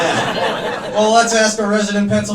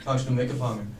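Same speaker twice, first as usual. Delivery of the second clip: low flirty pick-up line; flirting; 6 semitones lower, 4 dB quieter than usual. Acted. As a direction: drop it low, as if wooing. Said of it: "it depends on the intonation here".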